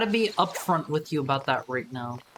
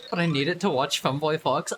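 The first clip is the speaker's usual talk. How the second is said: ordinary talk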